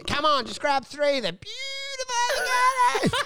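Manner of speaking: high, slow, wheedling imitation of the comic; mock pushy salesman. Imitating the comic pushing avocados, in a high wheedling voice. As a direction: ham up the avocado pitch, way up high.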